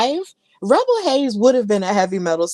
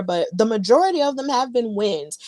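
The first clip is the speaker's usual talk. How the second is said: usual speaking voice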